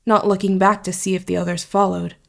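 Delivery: ordinary delivery, nothing marked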